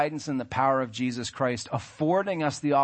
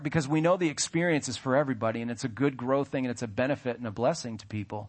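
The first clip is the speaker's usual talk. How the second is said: usual speaking voice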